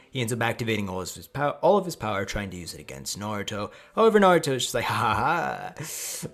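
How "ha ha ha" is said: slow, mocking fake laugh; drawn-out cocky cackle; taunting laugh. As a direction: laugh it out scornfully, stretched long. Naruto's mocking laugh, acted.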